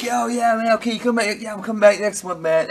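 high, loose imitation of a friend; lightly voiced friend saying goodbye; casual buddy impression. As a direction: toss it off like a laid-back buddy.

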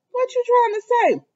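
high-pitched imitated challenge; mock questioning. Retelling Brady's challenge to her.

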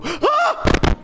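real jump-scare shriek; startled scream. Real, not acted. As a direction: gasp into a high loud scream. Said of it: "I got scared".

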